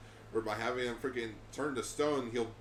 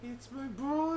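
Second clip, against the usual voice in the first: mock tearful; 13 semitones higher, slower than usual.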